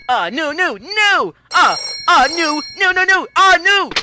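frantic, climbing cry of no; panicked rising cry. Acted. Panics at missing the call.

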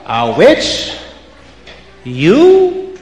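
witch sneering, voice leaping up on you; scoffing disbelief. Acted. Witches to Reb Shmuel, scoffing in disbelief.

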